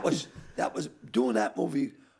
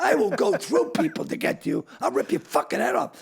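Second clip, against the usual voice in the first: loud, raised-pitch ranting threat; angry threat; 8 semitones higher, 5 dB louder than usual. Retold or imitated. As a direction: retell a furious tirade at full tilt. Acted retelling of his furious threat.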